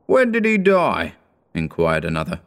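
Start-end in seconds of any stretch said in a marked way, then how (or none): 0.1-1.1 high, thin gossiping merchant; a reedy businessman asking. A narrator's reading. Another merchant asks.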